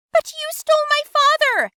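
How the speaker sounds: father stressed in a yelled repeat; escalated accusation. Acted. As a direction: hammer it home, shouting.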